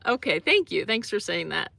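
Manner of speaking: ordinary talk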